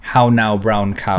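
slow, carefully rounded Australian vowels; proper Australian accent. Shown as a demonstration. Demonstrating the proper Adelaide accent, slow and careful. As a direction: enunciate like a proper Adelaide speaker. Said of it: "they speak a little more proper".

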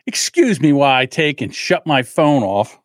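ordinary delivery

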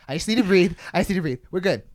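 frantic self-soothing, played up; retold freak-out. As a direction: imitate him trying to calm himself, flustered. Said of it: "he was freaking out".

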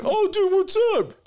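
high-pitched mock "oh dude" voice; high surprised buddy greeting. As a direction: play the guy caught off guard, friendly. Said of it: "He's like".

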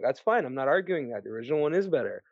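settled, unargumentative reply; calm concession. Acted. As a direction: concede the point evenly, dropping in pitch. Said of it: "I'm like".

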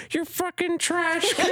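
put-on very high, slow little kid voice; tiny squeaky kid voice. Gamer mocking a kid's tiny voice, high and squeaky. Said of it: "the smallest little tiny voice".

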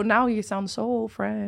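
soft, drawn-out English Canadian reaction; quietly mocked anglophone reaction; imitated English Canadian. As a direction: slowly imitate an English Canadian listener, softly. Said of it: "Quand j'allais au Canada anglais, il était comme".

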